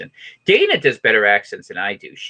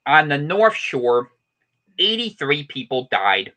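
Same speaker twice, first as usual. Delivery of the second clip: higher, punched announcer delivery with pauses; mock news report; 6 semitones higher than usual. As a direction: read it like a hammed-up local newscaster.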